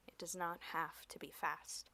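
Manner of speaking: unvoiced sultry whisper; whispered poem line. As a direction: breathe it softly into the mic.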